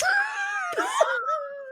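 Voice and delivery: long, very high wailing cry; mock despairing wail. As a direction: draw out a hammed-up wail. Acted wail after the quoted protest.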